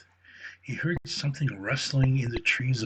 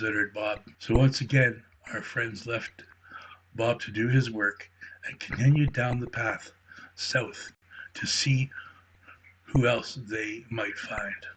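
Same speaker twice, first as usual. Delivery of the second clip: plain reading voice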